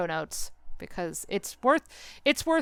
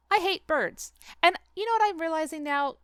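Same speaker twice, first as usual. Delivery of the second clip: high, vehement declaration; emphatic dislike; 8 semitones higher than usual. Real, not acted. Telling listeners she hates birds, high and vehement. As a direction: spit out the hatred. Confessing her hatred of birds.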